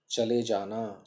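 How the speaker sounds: ordinary talk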